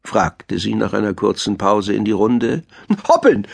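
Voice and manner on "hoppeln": high, quick little bunny voice; hare girl calling. A narrator's reading. Hare girl answers the owl's question, high eager call. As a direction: pipe up like an eager pupil. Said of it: "rief das Hasenmädchen".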